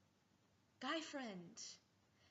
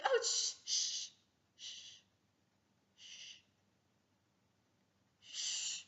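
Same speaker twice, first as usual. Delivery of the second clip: hushing; much slower than usual. Pretend. Hushing friend.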